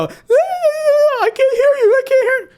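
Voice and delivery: high, loud mock-child wail; sing-song a kid covering his ears; childish taunt. Commentator mocking opponents as children, high wailing taunt. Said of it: "close their eyes like children and go".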